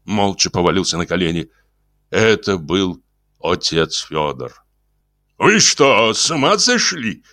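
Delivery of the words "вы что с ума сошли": high, indignant outburst; alarmed, raised-pitch question; startled exclamation. Acted. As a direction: exclaim as if jumping up in disbelief. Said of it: "воскликнул инженер, вскакивая".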